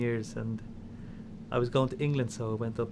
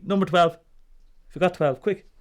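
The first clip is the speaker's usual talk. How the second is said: urgent; 5 semitones higher, 7 dB louder than usual. Retold or imitated. Sister urging her during the game.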